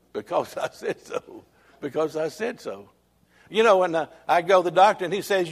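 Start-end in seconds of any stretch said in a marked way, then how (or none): 0.1-2.9 mock stern mama. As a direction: softly imitate a mother laying down the law. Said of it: "Not like my mama when she'd say".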